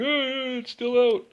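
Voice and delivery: high, whiny voice of clueless mechanics; mocking the clueless guys. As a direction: squeak it out like a helpless dope. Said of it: "They're like".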